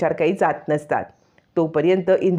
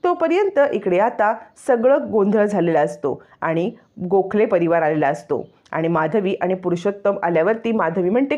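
usual speaking voice

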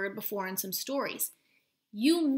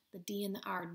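breathy soft whisper naming letters; 2 semitones lower, 6 dB quieter, faster than usual. Real, not acted. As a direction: whisper the letters like a secret clue. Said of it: "teacher whisper".

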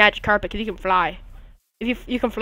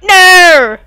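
loud, high retold yell; mock shout; 9 semitones higher, 17 dB louder than usual. Quoting his friend next to him, loud high yell. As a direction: shout it back at your friend. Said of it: "And he's like".

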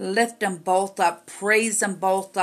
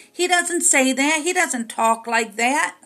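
rising, louder pulpit preaching; fiery preaching; 4 semitones higher, 3 dB louder than usual. Acted. Preacher rebuking the congregation for limiting God, forcefully.

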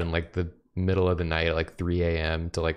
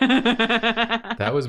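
laughing; 5 dB louder than usual. Real, not acted. Laughing at a friend's joke.

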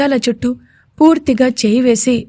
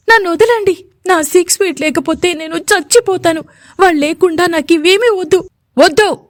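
patient fighting off the injection, raised voice; struggling yell; 7 semitones higher than usual. A narrator's reading. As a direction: cry out against being held down. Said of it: "అలాగే అరుస్తున్న".

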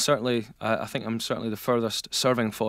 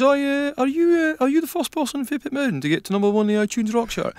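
much higher put-on voice for a pal; imitated pal; 13 semitones higher than usual. Pal asking the singer about his chart success, high mimicking voice. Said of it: "what was it your pal said to you?".

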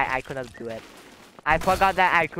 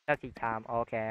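ordinary delivery

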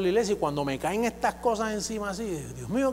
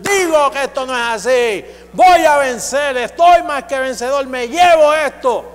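shouted confession; 5 semitones higher, 10 dB louder than usual. Shown as a demonstration. Preacher shows how to open the mouth and shout victory. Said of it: "abrir la boca y comenzar".

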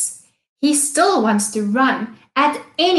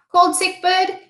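plain reading voice

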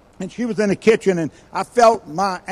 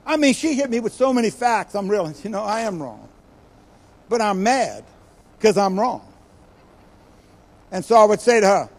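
ordinary delivery

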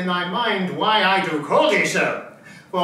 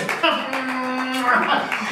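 big laugh; 7 semitones higher than usual. Acted. [name] mocking the fool letter.

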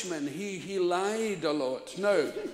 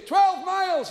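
farmer's answer; 9 semitones higher, 6 dB louder, slower than usual. Retold or imitated.

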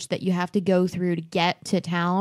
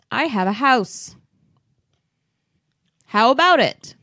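loud, pitched-up retold sales pitch; mock proposal; 3 semitones higher, 5 dB louder than usual. Man pitching marriage with just a house, mock proposal. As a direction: toss out a blunt offer, mockingly loud.

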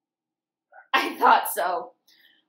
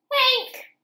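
high, louder name reveal; excited call; 14 semitones higher, 5 dB louder than usual. Acted. Reading to kids, loud high reveal of Hank. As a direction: pop the name up. Announces dog waking.

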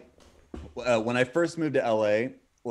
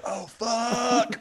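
mock panic; 11 semitones higher than usual. Guy pulls something from car.

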